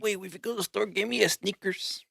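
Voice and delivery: playful put-on Mexican accent; light Mexican accent. Joking with a buddy about a store run, light Mexican accent. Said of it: "We got different accents now".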